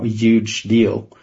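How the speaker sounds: usual speaking voice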